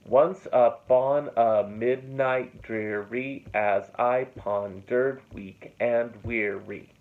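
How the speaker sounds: slow poetry recital marking every stress; slow metrical reading. Shown as a demonstration. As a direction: read slowly, leaning on each stressed beat. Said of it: "let's find a good example".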